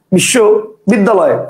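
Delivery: বিশ্ববিদ্যালয় in two chunks, one pause between; two separate pieces. Shown as a demonstration. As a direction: break বিশ্ববিদ্যালয় into bissho, then biddaloy. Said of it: "দুইটা". (বিশ্ববিদ্যালয় দুই ভাগে, মাঝে থেমে)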